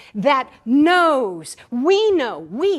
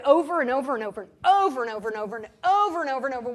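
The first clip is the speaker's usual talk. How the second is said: rattled-off repetition; 2 semitones lower, much faster than usual. Acted. Repetition mocking endless retelling.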